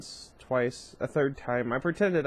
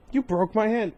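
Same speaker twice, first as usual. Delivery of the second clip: indignant outrage; 8 semitones higher, 5 dB louder than usual. Acted. Accusing after punching him.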